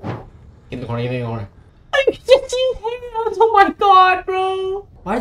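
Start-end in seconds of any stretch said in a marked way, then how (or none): 1.9-4.9 very high, drawn-out squeal; panicked whine. Real, not acted. Player panicking at the game, high whine. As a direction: squeal it in helpless dread.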